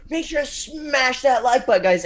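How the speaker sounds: high, loud over-the-top hype; hyped YouTuber voice. Retold or imitated. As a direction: blast it like a crazed YouTuber. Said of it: "channels ... that would go crazy".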